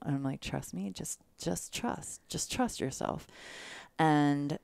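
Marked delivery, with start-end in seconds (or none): 0.4-3.3 soft, near-whispered trust me; softly reassuring a nervous newcomer; hushed reassurance. Retold or imitated. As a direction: coax her gently, almost whispering. Reassuring whispered advice to nervous woman.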